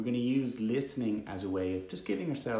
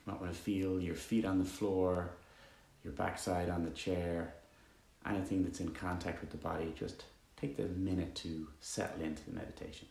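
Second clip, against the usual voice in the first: calm, even meditation voice with long pauses; guided meditation; 2 semitones lower, 8 dB quieter than usual. Acted. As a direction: guide listeners gently into stillness, leaving long silences.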